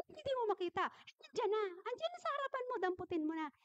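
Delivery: high-pitched retold motherly exasperation; impatient mother. Mother to her child who can't find the garlic, high impatient retelling. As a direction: imitate a mother losing patience, pitched well up.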